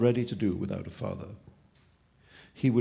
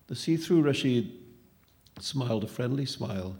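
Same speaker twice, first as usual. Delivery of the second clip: plain reading voice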